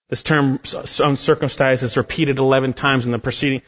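ordinary delivery

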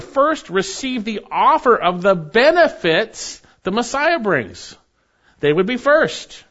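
high, ringing sermon delivery; impassioned preaching. Acted. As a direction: drive the point home from the pulpit. Preaching at full force.

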